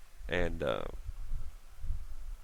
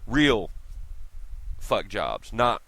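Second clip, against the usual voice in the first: ordinary talk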